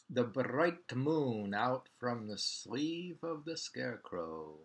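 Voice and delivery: slow, weighty haiku line; poem reading. Shown as a demonstration. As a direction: read it out slowly like a poem.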